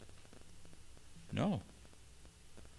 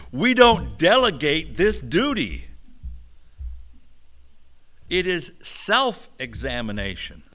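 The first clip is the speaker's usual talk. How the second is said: forceful preaching; 4 semitones higher, 8 dB louder than usual. Real, not acted. Preacher insists after his joke.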